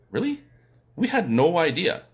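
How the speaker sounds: high-pitched, imitated disbelief; mock astonishment. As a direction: play an amazed listener hearing news. Acting out astonished American listeners.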